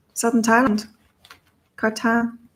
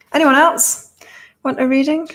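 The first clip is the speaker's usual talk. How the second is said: higher, louder call to the chat; 6 semitones higher, 3 dB louder than usual. Real, not acted. Host calling out to live viewers, bright open call. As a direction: fling the question out to everyone watching.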